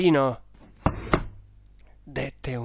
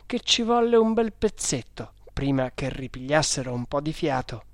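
usual speaking voice

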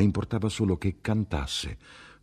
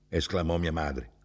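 ordinary delivery

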